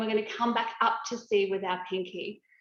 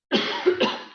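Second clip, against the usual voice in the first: real throat-clearing cough; 6 dB louder than usual. Real, not acted. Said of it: "I've got a tickle in my throat today".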